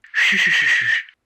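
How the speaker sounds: loud wicked cackle; evil laugh. Acted. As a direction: cackle like a gleeful killer. Villain laughs during murder.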